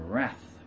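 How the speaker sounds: "wrath" with an open a, like "rath"; "wrath" said the American way, vowel as in "cat"; American pronunciation of wrath. Shown as a demonstration. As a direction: say "wrath" to rhyme with "math". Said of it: "I still use the American pronunciation".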